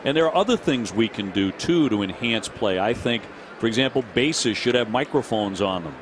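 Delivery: normal talking voice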